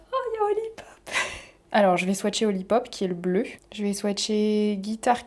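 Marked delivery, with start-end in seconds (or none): 0.1-0.9 very high, small squeal; tiny high-pitched coo of excitement; delighted squeal. Real, not acted. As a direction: squeak it in delight, far above normal pitch. Delighted to find a shade.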